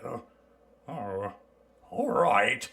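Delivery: usual speaking voice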